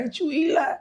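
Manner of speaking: high, amazed ain't you; suddenly recognizing a famous face; high, disbelieving question; surprised recognition. Retold or imitated. Dude recognizes him.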